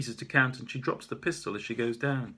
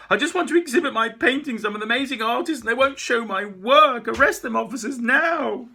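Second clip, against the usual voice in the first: much higher, loud frantic yell; shouting back; 13 semitones higher, 13 dB louder than usual. Acted. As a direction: scream your demand. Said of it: "She shouts back".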